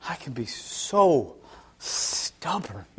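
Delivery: slow, softened admission; quiet self-reproach. Retold or imitated. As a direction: let it sink to a hush, drawn out. Rueful realisation about himself.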